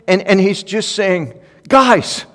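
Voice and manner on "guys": much higher and louder, a fiery call; Jared preaching passionately. Retold or imitated. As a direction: rouse the room like a passionate young preacher. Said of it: "that's how he talks".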